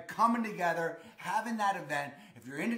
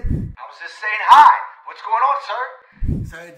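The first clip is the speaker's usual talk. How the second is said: re-enacted shout of a friendly hi; loud acted greeting; 3 semitones higher, 18 dB louder, slower than usual. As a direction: call it out to a passing stranger. Acting out his own friendly reply.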